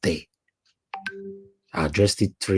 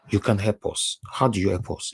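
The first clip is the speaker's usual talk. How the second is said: ordinary talk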